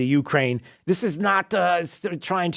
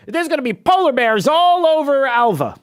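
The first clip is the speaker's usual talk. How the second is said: much higher, louder mock zealot; mock triumphant believer; 11 semitones higher, 5 dB louder than usual. Mocking climate activists with a loud triumphant voice. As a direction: ham it up like a giddy true believer.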